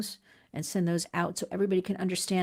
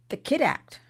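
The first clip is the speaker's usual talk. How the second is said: scornful, higher mock of the bill's short name; 7 semitones higher than usual. Shown as a demonstration. Host mocking the short name of the bill, scornful. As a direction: sneer at the bill's bad name. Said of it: "they couldn't even come up with a good name".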